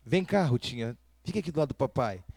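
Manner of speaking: father calling his crying daughter to his side, softly; tender soothing. Retold or imitated. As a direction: coax her close, quietly.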